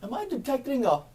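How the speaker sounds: high, fast flirty stranger voice; flirty guy. Retold or imitated. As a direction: play the charming guy at the counter. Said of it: "the guy goes".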